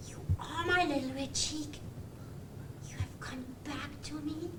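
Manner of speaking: whispered. Acted. Puppet greets lost cheek.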